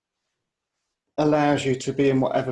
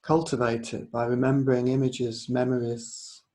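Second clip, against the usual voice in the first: unhurried yoga nidra guidance; guided meditation. Real, not acted. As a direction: guide them gently inward, leaving space between phrases.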